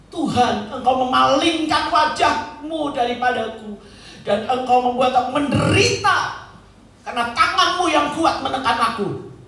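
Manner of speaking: acted protest to God, a touch angry; Daud. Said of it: "dengan mereka marah". (ratapan protes kepada Tuhan)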